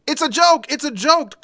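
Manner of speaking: high, loud, panicked muffled yell; muffled shout. Retold or imitated. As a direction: yell it as if shut in a car trunk. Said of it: "called a muffled voice from the trunk".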